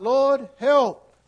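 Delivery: loud, high desperate cry; a sudden loud plea, high and urgent; crying out to God. Acted. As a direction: call out for help at full voice. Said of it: "cry out".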